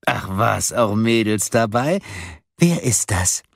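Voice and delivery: a caller toying with a new victim; mocking caller. A narrator's reading. Caller discovers a girl is listening, mockingly curious.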